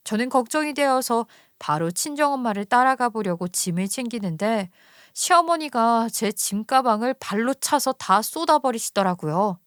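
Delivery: ordinary delivery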